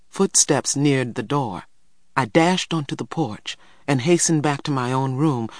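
ordinary delivery

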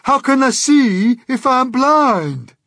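slow, high-pitched indignant shout. A narrator's reading. Blind Earthworm protesting to James, shouting. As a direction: shout it, drawing out each word. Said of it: "shouted the poor earthworm".

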